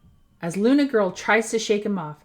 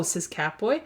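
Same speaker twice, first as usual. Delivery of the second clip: usual speaking voice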